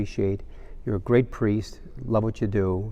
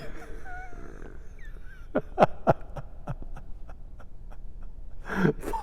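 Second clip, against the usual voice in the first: long wheezy laugh at own joke; helpless laughter; 9 dB quieter than usual. Real, not acted. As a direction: crack up and fade into wheezing.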